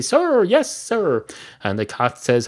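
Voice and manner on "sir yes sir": high-pitched mock recruit answering an order; military response. Barman answers the cat's order, high military response. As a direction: sing out an obedient military reply, much higher. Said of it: "sounds like an order".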